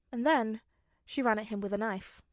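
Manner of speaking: ordinary delivery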